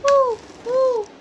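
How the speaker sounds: high owl hoot ooh ooh; mother showing her toddler the owl's call, high hoot. Pretend. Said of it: "The owl says".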